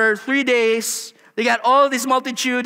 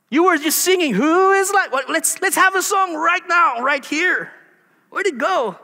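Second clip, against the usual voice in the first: mock challenge; 5 semitones higher than usual. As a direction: prod them about their lost song, half teasing. Preacher taunts grumbling Israelites.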